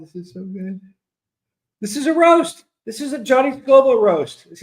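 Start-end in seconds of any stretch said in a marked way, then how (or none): 1.8-4.5 excited exclaim. Real, not acted. Delighted by roast comments.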